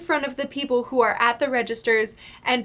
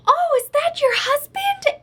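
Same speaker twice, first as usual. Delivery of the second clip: very high, drawn-out mock curiosity; nosy shopper; 4 dB louder, slower than usual. Woman peering over girl's shoulder at her phone, high nosy imitation. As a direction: imitate an intrusive stranger prying.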